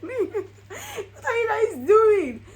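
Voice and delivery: drawn-out happy wail; overwhelmed laughing cry at the proposal. Retold or imitated. Retold reaction, laughing.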